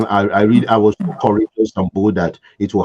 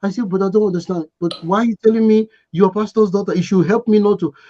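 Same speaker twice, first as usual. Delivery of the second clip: begging; 7 semitones higher, faster than usual. Retold or imitated. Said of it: "She was begging".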